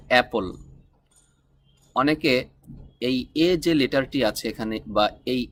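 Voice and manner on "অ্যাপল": অ্যাপল opening on a short flat "a" sound; correct pronunciation. Shown as a demonstration. Teacher models অ্যাপল for learners, correct pronunciation. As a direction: say অ্যাপল with "a" as in cat. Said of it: "এর সঠিক উচ্চারণ হবে".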